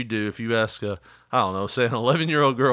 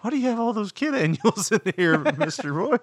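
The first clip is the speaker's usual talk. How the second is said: higher, baffled mock question with a laugh; incredulous question; 8 semitones higher than usual. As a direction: ask the barber as if it makes no sense. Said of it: "you're like".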